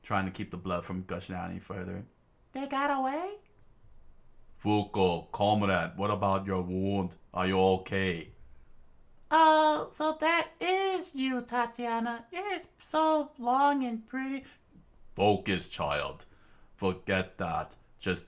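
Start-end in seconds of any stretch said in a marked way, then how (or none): 2.5-3.4 high voice, a touch surprised; surprised girl. Acted. 4.6-8.3 put-on light Russian accent, concerned. Tatiana, Russian voice. 9.3-14.4 high voice, slow and admiring; admiring girl. Acted.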